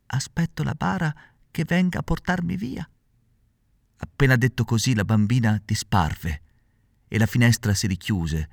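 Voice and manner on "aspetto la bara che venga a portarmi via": put-on high, soft ghostly child voice; a tiny voice from the other world; otherworldly little voice. A narrator's reading. Girl at the window answering Pinocchio, ghostly and faint. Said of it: "con una vocina che pareva venisse dall'altro mondo".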